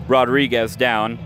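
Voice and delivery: ordinary delivery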